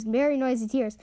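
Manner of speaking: ordinary delivery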